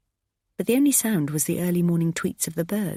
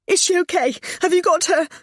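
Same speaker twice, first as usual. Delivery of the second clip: high, loud frantic question; desperate question; 14 semitones higher, 6 dB louder than usual. Acted. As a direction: beg for the answer. Mother on phone desperate.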